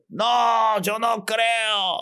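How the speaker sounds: stubborn refusal. Acted.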